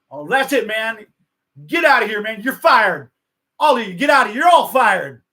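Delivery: high, loud acted outburst. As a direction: blow up and fire everyone, imitating a star's tantrum. Acted [name] fires his whole entourage.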